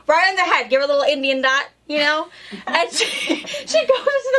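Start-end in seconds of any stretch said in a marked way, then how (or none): 2.7-4.4 high laugh breaking into the words; laughing through the story. Real, not acted. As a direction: giggle through the line. Laughing while retelling story.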